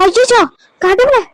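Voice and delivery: high, slow, drawn-out wail of feigned horror; mock alarm. Schemer fakes horror at the news, high drawn-out wail. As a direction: stretch out a theatrical cry to God.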